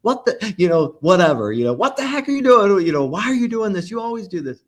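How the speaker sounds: playfully hammed-up jealous outburst; a jealous partner attacks in a hammed-up rant, very high and loud; hard-ball attack. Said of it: "Nobody wants to get that hard ball of".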